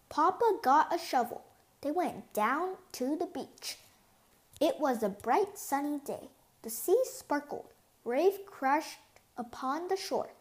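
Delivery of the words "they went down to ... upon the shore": usual speaking voice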